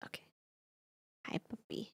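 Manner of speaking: whispered pet talk; whispering. Real, not acted. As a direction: whisper softly to the cat. Whispering to the cat.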